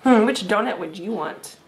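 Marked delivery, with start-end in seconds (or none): none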